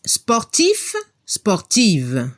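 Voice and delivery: ordinary delivery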